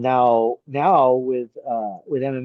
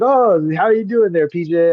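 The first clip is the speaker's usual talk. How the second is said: higher, louder friendly put-on voice of the caller; Herb Dean voice; 5 semitones higher, 6 dB louder than usual. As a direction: play the cheerful older referee on the phone. Imitating Herb Dean on phone.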